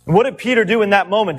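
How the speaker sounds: usual speaking voice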